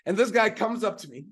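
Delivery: ordinary talk, nothing marked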